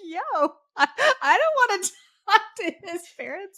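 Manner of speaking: high laughing protest; laughing disbelief. Retold or imitated. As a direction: laugh while refusing in disbelief. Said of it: "I was like".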